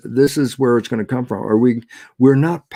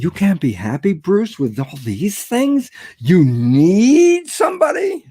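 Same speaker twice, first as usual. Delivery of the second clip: rising, slow, incredulous question; mock disbelief at himself, climbing higher; slow mock disbelief, ending much higher and louder; incredulous self-mockery; 6 semitones higher, 4 dB louder, slower than usual.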